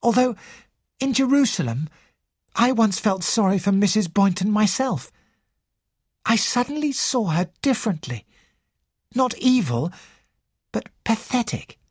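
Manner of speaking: high character voice, hesitant and thoughtful; Sarah, reflective. Acted. Sarah admits feeling sorry for old woman, thoughtfully.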